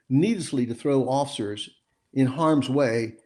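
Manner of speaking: normal talking voice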